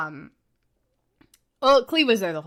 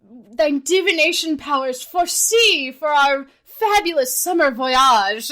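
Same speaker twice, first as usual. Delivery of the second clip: over-the-top theatrical; 4 semitones higher, 5 dB louder than usual. Retold or imitated. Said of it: "talks like this".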